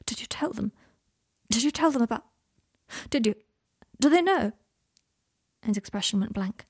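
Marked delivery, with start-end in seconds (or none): 0.0-4.5 urgent. A narrator's reading. Patient asks his visitor what was revealed, urgent. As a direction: press urgently, breaking off and restarting. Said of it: "said Draco urgently".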